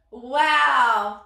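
usual speaking voice

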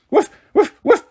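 high, eager gobbling-dog barks; playful dog woofs. A narrator's reading. Mister Henry playing Fido taking a treat, high playful woofs. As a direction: pretend to be a toy dog wolfing a treat. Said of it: "pretending Fido was galloping and golloping".

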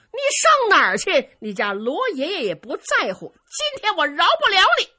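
loud, high-pitched battle threat; furious threat. A narrator's reading. Luo Cheng threatening the fleeing Dongfang Bo, furious threat. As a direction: shout defiantly after a fleeing enemy.

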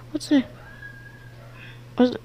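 ordinary delivery

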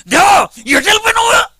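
very high, strained cry to God; acted anguished holler, pitch shooting up; loud yelling. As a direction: scream it out as if with clenched teeth. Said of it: "గట్టిగా అరుచుని ఉంటారు".

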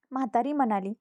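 ordinary delivery, nothing marked

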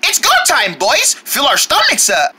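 ordinary talk, nothing marked

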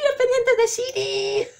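high, slow, thrilled cry; excited squeal. Real, not acted. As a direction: call it out with glee. Excited at the Siri earrings.

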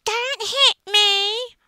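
high, loud, drawn-out whine, mocking the girl; slow, loud, high put-on little girl wail; mock whiny child. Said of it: "And she said".

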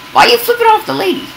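put-on a shrill parent telling off the child; dad scolding son for a rude gesture, high and quick; mock scolding.

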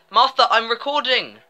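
loud, high call across the house; real shout. Real, not acted. Player telling Martha off for interrupting a recording, shouting. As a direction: yell it to someone interrupting you. Calls out to someone off-mic.

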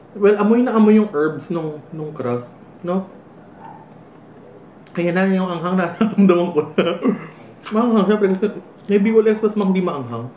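chewing between words; talking while eating; mouth partly full. Real, not acted. Seen 0: Left speaker holds bitten slice near mouth, mouth working between words.

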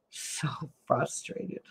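slow, breathy whisper; whispered frustration. Real, not acted. Vlogger venting to camera, whispering her frustration slowly. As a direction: drag it out under your breath.

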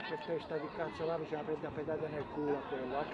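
low, very soft, quick muttering; grumbling under breath. Real, not acted. Spectator cursing a player to himself, muttered grumble. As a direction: mutter the curse aside, barely voiced.

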